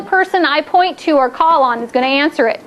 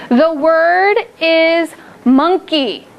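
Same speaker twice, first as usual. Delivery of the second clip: slow teacher voice; much slower than usual. Shown as a demonstration. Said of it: "teacher says word slowly".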